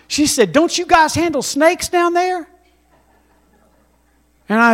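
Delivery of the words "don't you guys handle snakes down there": put-on incredulous old lady. Old woman's question quoted.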